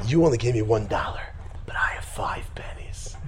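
hushed, unvoiced-sounding whisper; whispered quote. Boy in the poem to his father, whispered. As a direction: whisper it like a secret boast.

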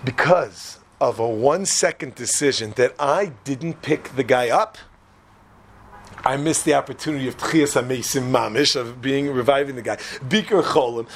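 low, slow brooding; solemn regret. Acted. Friend realizing what he passed up, solemn regret. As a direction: weigh each phrase with regret.

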